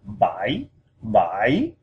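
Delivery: "bai" dipping down, then sweeping up like a question; falling-rising tone. Shown as a demonstration. Teacher showing number seven, "bai" going down and up. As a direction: drop then lift the pitch on "bai". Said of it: "So it goes down and up".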